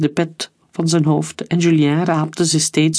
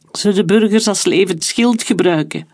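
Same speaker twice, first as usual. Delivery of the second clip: ordinary delivery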